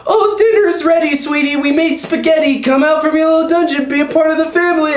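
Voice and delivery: high, sugary mother calling to dinner; sweet mom voice. Retold or imitated. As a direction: sing it out like a doting mom. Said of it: "it's his mom, and it's like".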